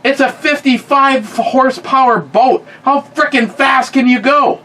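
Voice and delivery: high-pitched, incredulous rant; exasperated protest. Retold or imitated. Teen protesting the complaint about water skiing too fast, exasperated.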